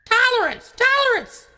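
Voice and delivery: very high squeaky bird voice; cartoon bird squawk; chirping bird. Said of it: "one bird is like".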